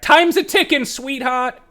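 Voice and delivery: very high, loud taunting call; heckling gnome. Acted. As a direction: jeer at her from the back. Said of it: "you hear in the back".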